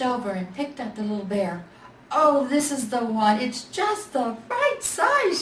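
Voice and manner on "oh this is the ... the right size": much higher, slow delighted lady; smiling lady. A narrator's reading. The smiling lady picks the little bear, high and delighted. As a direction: coo over the perfect bear. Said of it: "she said".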